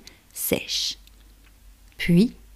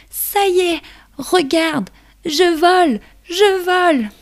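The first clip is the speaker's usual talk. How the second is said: very high, loud, thrilled ladybug; overjoyed little ladybug flying; excited ladybug voice; 11 semitones higher, 3 dB louder than usual. Acted.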